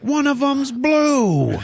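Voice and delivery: very high, drawn-out shout; mock exasperated yell. Rocket butting in on the dad mix-up, high comic yell. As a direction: yell the obvious, stretching the last word.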